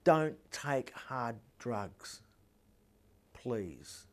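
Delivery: very slow, soft plea; subtle plea. Acted. Father hopes daughter picks up a hint, slow hushed pleading. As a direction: spell it out gently, dropping low on the last word. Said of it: "the subtle little hints like".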